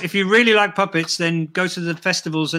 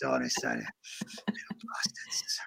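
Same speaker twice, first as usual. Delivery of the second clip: slow whispered sneer, much softer; muttered aside; 16 dB quieter, slower than usual. Acted. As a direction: hiss the insult under breath.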